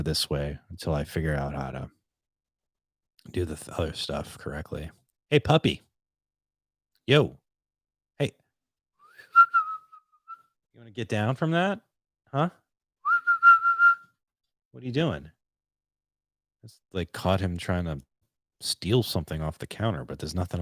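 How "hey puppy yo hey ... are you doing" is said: high-pitched puppy call; dog talk. Real, not acted. Vlogger catching the puppy at the counter, high dog-talk. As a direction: pitch it up to get a dog's attention.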